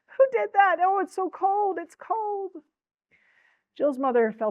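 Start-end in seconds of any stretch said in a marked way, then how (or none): 0.1-2.6 very high wail, loud then fading; beggar's shocked cry. A narrator's reading. Beggar doused with ice water from the window, high wailing. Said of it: "cried the beggar".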